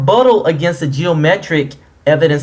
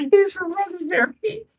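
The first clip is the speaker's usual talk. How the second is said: mock crying voice; 7 dB quieter, slower than usual. Mocking opponent's Boston town.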